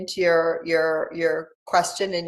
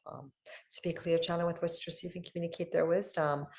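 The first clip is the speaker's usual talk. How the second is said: soft, quiet prayer voice; 4 semitones lower, 8 dB quieter than usual. Acted. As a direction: pray it softly and steadily. Says her little prayer.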